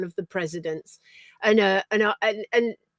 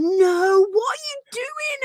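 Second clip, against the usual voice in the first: alarmed friends; 13 semitones higher, 4 dB louder, much slower than usual. Retold or imitated. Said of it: "Lou and Martha were going".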